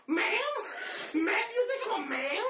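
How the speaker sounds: high, loud indignant ma'am; mock outrage. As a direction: act offended, pitch flying up. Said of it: "started going hard on this guy".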